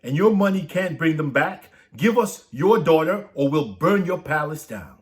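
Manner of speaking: high, loud, furious demand; angry threat. Acted. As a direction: threaten the king outright. Angry townspeople threaten the king.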